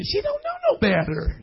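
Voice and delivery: very high, squeaky cooing; pitying baby talk. Shown as a demonstration. Preacher about little Bethany, pitying baby talk. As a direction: coo it indulgently, way up high. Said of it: "You gotta say it like that".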